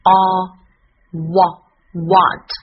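"a, wa, what" slow and loud, built piece by piece; sound-by-sound build-up. Shown as a demonstration. Teacher blending sounds for learners, "what" slow and emphatic. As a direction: build up "what" from its vowel, with pauses. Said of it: "A的发音是".

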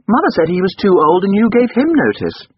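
put-on high, loud boy's voice; young master insisting. A narrator's reading. Boy challenging the servant about George, high and insistent. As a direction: press the point in a high child's voice.

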